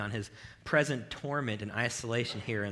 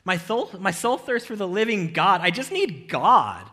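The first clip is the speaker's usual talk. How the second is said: high, loud insistent protest; preaching the psalmist's cry loudly; impassioned declaration; 8 semitones higher, 12 dB louder than usual. Acted. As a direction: declare it with fervent force. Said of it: "He's like".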